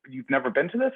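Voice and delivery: high, rising disbelief; incredulous question. Retold or imitated. Friend questions him at the Tony luncheon, incredulous. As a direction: mimic a friend's astonished question.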